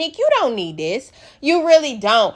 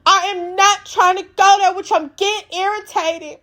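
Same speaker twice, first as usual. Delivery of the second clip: pleading Nick; 7 semitones higher than usual. Retold or imitated. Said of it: "She was like".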